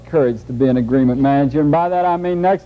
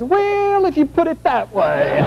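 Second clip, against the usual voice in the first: a lightly voiced, much higher, sheepish backing-down; sheepish mob member; 9 semitones higher than usual. A mob member caving to the marshal, high sheepish mock voice. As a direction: play a tough guy suddenly giving in, pitched way up.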